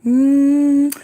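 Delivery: long sustained "mmm"; drawn-out hum for a kiss; kissing sound. Pretend. Sending viewers kisses, long affectionate mmm. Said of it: "give me some kisses".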